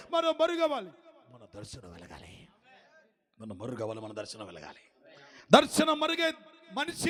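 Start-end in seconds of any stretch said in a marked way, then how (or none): 0.1-0.9 high, ringing call; loud pulpit proclamation; proclaimed. Shown as a demonstration. Loud then hushed repeat. 3.4-5.1 lower, soft repeat; quiet echo of the call; hushed echo. Shown as a demonstration. Loud then hushed repeat.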